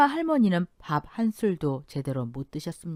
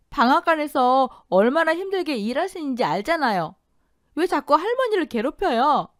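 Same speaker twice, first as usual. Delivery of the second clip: pleading outburst; 9 semitones higher, 6 dB louder than usual. A narrator's reading. Daughter pours out anger.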